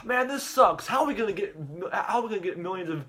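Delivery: high-pitched mock complaining; acted frustration.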